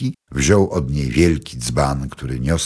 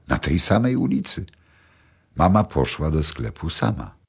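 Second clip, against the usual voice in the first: ordinary delivery, nothing marked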